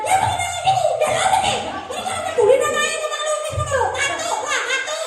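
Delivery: shrill-pitched parody of a parent scolding; mock scolding mother. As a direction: ham up a nagging mother, half laughing.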